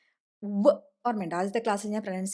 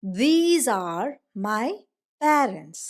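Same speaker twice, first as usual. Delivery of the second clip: slow, louder model reading; slow model sentence; 4 dB louder, much slower than usual. Shown as a demonstration. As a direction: read it out slowly for the class. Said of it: "എങ്ങനെ നമ്മൾ പഠിച്ചേ?".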